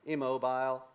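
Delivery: ordinary talk, nothing marked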